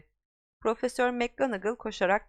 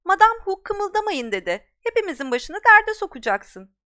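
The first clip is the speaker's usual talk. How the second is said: high, loud anxious scold; urgent scolding; 10 semitones higher, 9 dB louder than usual. Acted. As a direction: warn him off urgently. Girl scolding Harry.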